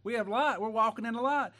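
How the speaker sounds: loud, high preaching cry. Acted. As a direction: preach it out to the congregation. Preacher at full force.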